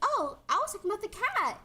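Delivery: put-on high innocent girly voice; mock cutesy girl. Friend mocking the heroine's reply, high and innocent. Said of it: "she's like".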